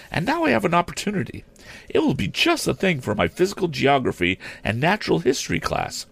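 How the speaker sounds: high-pitched, quick, gushing teacher; excited lady teacher. Acted. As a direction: gush about it in a high, hurried voice.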